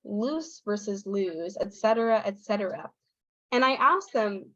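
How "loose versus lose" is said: LOOSE short, LOSE long; slow contrast, short LOOSE against lengthened LOSE; vowel length contrast. Shown as a demonstration. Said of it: "difference in the vowel length".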